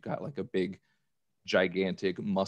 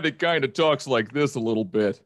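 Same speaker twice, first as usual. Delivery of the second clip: Kronk voice; 5 semitones higher, 5 dB louder than usual. Retold or imitated. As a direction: lean into the dopey Kronk sound. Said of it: "everybody always kinda says I talk like him".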